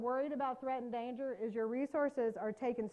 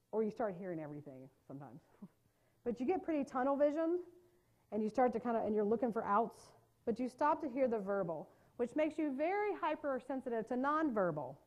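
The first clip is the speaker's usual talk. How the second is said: normal talking voice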